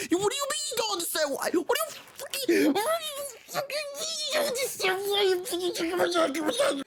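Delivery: high, incredulous outburst; cracking high-pitched outrage; losing it. Real, not acted. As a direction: boil over in disbelief. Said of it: "he gets me all over, emotional".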